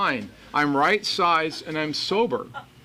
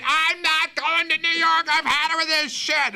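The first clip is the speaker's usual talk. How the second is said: high, shrill outburst, much higher; furious old woman; 13 semitones higher than usual. Retold or imitated. Cranky woman on the diverted plane, high and furious. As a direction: explode like a cranky passenger refusing. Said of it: "she jumps out of her seat".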